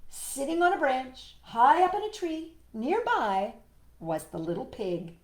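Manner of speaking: high, phrase-by-phrase reveal, settling at the end; animated storytime voice; story narration. Acted. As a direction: build suspense for a small child. Reads picture book to child.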